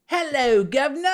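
high, loud hammed-up Cockney-style British; obnoxious British accent. Mocking the silly kind of British, obnoxious accent. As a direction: belt out a silly British greeting. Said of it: "Just obnoxious".

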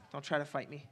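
ordinary talk

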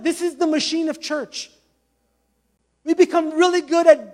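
urgent preacher building a list; impassioned preaching. Real, not acted. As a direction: preach it hard, piling up each point. Preaches with rising force.

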